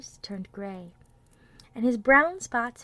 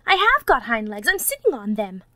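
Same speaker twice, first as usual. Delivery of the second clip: insistent protest; 8 dB louder than usual. A narrator's reading. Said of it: "cried the little rabbit".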